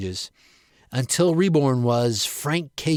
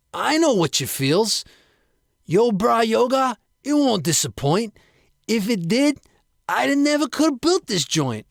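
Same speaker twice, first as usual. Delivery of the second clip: Frank's bro voice; 9 semitones higher than usual. Acted. Frank to yoga doubters, brash bro pitch. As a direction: hype up the yoga studio like a gym bro.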